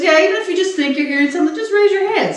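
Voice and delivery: high, quick imitation of a tester; lightly put-on high voice giving instructions; imitated hearing tester.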